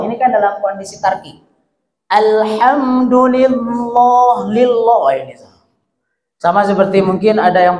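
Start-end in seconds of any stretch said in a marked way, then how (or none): 2.1-5.4 slow "Alhamdulillah" with a heavy dark o, "lilloh"; tafkhim error. Shown as a demonstration. Teacher showing the heavy wrong reading. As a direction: darken "lillah" into "lilloh". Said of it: "mentafkhimkan lafaz Allah".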